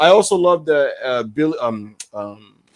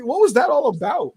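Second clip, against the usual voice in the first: ordinary talk, nothing marked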